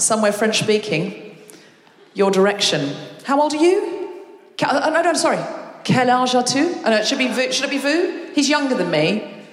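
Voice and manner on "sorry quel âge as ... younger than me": ordinary talk, nothing marked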